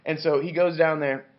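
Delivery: ordinary delivery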